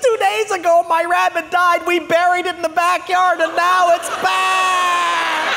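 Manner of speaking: high, loud terrified wail, slowing at the end; hysterical screaming. Retold or imitated. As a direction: shriek it out in horror, dragging the last words. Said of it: "She says".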